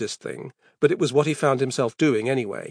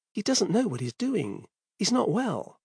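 worried murmur; 7 semitones higher, 7 dB quieter than usual. Acted. Father worried, to himself.

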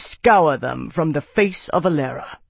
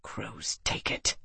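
whispered mutter under the breath; 4 semitones lower, 12 dB quieter, slower than usual. A narrator's reading. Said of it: "he muttered under his breath".

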